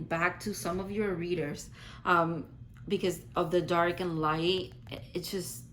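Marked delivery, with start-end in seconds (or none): none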